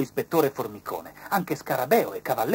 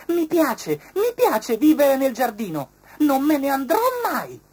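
butterfly's voice; 9 dB louder than usual. A narrator's reading. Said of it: "disse Fuzzi la farfalla".

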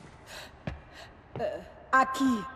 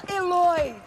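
sharp alarmed shout of a name; 7 semitones higher, 5 dB louder than usual. Acted. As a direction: call out to stop her. Matriarch shouts to stop her.

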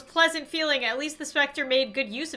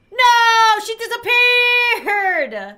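much higher and louder, dismayed; shocked cry; 9 semitones higher, 10 dB louder, much slower than usual. Real, not acted. Gamer shocked that the ghost vanished, crying out loud. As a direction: cry out as the ghost vanishes, with "she disappeared" drawn out slowly.

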